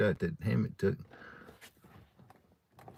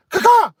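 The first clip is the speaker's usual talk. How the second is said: very high shrieking caw; loud crow caw; 16 dB louder than usual. Retold or imitated. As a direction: belt out a crow screech. Demonstrating his crow call.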